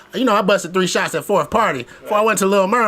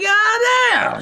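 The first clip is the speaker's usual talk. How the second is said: higher, startled god damn; shocked exclaim; 14 semitones higher than usual. Retold or imitated. Looking up the estate online, shocked outburst. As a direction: react like you just saw the number. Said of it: "I'm like".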